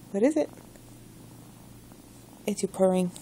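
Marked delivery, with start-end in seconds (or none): 0.1-0.5 put-on excited baby talk. Playful question to cat.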